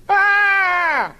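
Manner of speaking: very high, loud drawn-out wail; child's wail. Acted. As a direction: scream like a thwarted small child. Small boy wails at mother.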